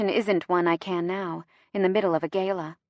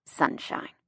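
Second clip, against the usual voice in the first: whisper; 7 dB quieter than usual. A narrator's reading. Quiet exchange pressed close beside her.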